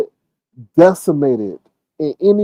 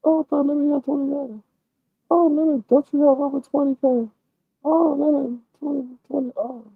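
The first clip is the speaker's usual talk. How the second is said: higher, softer sly hinting voice, lightly done; 10 semitones higher, 5 dB quieter than usual. Retold or imitated. Mock woman hinting at the man's money, sly and high. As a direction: drop the hint slyly, pitched up. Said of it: "Let it slip is like".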